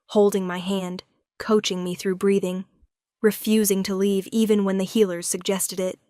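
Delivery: usual speaking voice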